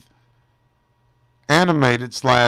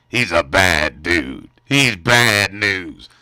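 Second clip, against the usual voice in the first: menacing villain voice; 6 semitones lower, 5 dB louder, much slower than usual. Acted. Hyping the villain Mike.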